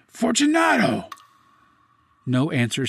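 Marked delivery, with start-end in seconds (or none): 0.1-1.1 louder, very high shouted name; calling aloud. A narrator's reading. Captor calls into the niche after no answer, shouting. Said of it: "I called again".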